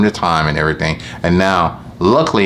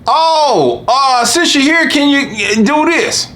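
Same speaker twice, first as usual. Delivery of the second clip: very high, slow, wheedling customer voice; mimicking customer; much slower than usual. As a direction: stretch it out high like a customer asking for freebies. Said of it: "then they'll say".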